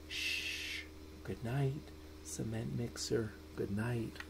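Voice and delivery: soft lullaby whisper; hushed goodnight. Acted. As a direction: hush it like a sleeping child is near. Bedtime refrain hushed to sleep.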